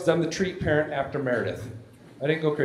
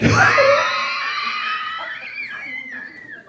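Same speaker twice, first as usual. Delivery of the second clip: long mock shriek, very high; piercing imitation screech trailing away. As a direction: screech like a witchy rival parent. Imitates Meredith approaching.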